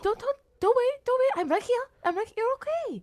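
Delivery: frantic reassurance. Acted. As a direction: soothe her while panic rises. Uncle comforting vanishing niece.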